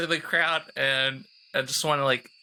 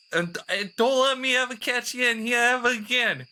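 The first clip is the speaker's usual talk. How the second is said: higher-pitched taunting yell; parting threat; 7 semitones higher, faster than usual. Acted. Character shouting back at the bully while fleeing. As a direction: fling a threat over your shoulder.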